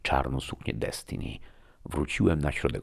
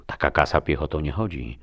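usual speaking voice

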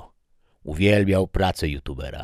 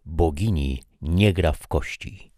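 bright upbeat video host read, louder and higher; youtuber presenter; 4 semitones higher, 6 dB louder than usual. Acted. Youtuber closing his physics episode to viewers, upbeat presenter read.

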